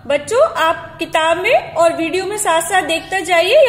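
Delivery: usual speaking voice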